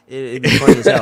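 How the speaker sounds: laughing through the words; amused, laughing aloud. Real, not acted. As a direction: chuckle it out as you say it. Laughing while picturing dad.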